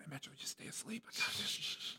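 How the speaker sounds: whispered, sneaking into the house; sneaky whisper. Retold or imitated. Whispering to sleeping Metro while sneaking in, hushed. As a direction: hush it like a burglar tiptoeing.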